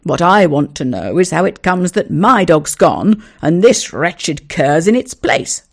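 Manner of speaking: loud, outraged denial; indignant denial. Acted. Neighbour denying theft to the owner, loud and indignant. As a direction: fling the charge right back.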